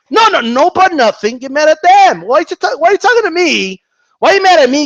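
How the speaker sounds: high, insistent scolding; exasperated protest. Pretend. Vlogger tells fans to blame their fighters, exasperated protest. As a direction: push back at imagined accusers, pitch way up.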